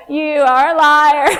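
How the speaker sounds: laughing, loud mock accusation; mock accusation, bigger. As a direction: really lean into calling them a liar. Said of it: "I'll be like".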